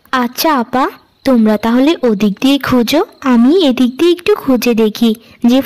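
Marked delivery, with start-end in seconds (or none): none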